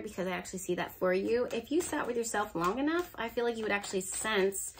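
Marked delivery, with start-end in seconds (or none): none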